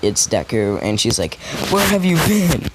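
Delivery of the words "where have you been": put-on girl's voice. Childhood friend calls out.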